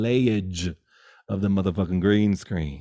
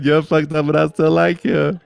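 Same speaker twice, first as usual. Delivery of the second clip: higher and louder, joyful cry; loud proclaiming; 6 semitones higher, 9 dB louder than usual. Real, not acted. Shouting the friend's title out to the listeners, loud and high. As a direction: call it out to the whole room.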